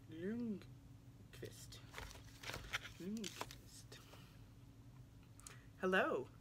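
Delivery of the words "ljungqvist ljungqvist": whispering the name over to get it right; whispered practice. Real, not acted. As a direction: mouth the surname to yourself. Quietly practising the author's surname.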